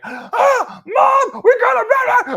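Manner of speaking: very high, screechy mock kid yell; yelling movie kid. Host imitating the movie's main kid, yelling frantically. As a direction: shriek it like an overacting child. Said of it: "every time he talks, ... Yells. he's like".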